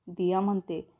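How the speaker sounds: usual speaking voice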